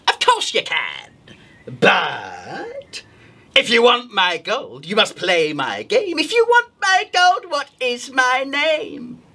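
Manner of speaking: creepy witch rising high, slowing at the end; witch riddle. Acted. Witch to the rabbit, creepy riddle. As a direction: sing the riddle like a sly witch. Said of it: "the witch said".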